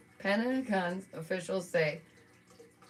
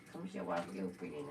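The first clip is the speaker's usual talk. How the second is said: much lower, soft fast coo to a pet; cooing to the cat; 12 semitones lower, 9 dB quieter, faster than usual. Real, not acted. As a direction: coax the cat softly. Coaxing her cat on stream.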